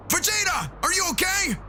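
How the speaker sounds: high, shouted alarm; Goku screaming. Acted. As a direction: yell it out high and worried. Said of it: "Goku screams".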